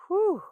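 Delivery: loud high whew; hot exhale. Retold or imitated. Describing hot flashes.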